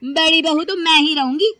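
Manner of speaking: loud, high, defiant protest; indignant talking back. Acted. Daughter-in-law answers back to mother-in-law.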